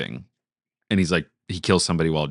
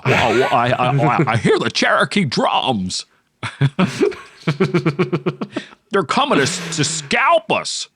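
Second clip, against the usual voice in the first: Jimmy Stewart impression; 6 semitones higher, slower than usual.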